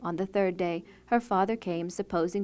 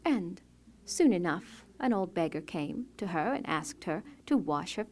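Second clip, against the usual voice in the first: usual speaking voice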